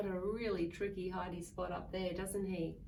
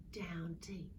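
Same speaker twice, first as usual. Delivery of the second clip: soft whispered ending; 5 semitones lower, 7 dB quieter than usual. A narrator's reading. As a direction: whisper them to sleep. Lulling ending.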